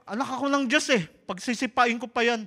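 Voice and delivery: high, loud, fearless boast; bold declaration. Retold or imitated. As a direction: declare it with bravado. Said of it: "sabi ko". (matapang na sigaw)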